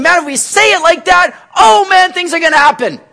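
overexcited believer expecting magic; parodying naive hype; mock excitement. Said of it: "And we think".

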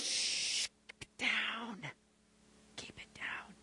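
whispered shushing; hushing. Acted. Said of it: "to kind of quiet us down".

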